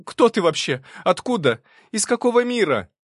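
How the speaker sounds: loud, high panicked questions; voice breaking. A narrator's reading. Frightened girl demanding who her creator is, high breaking cry. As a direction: climb into near-shouted panic. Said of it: "Её голос сорвался".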